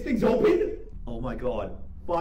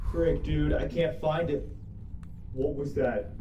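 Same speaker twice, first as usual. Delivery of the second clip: ordinary talk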